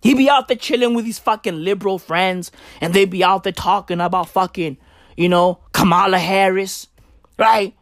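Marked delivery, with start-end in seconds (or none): none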